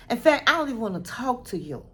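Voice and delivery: ordinary delivery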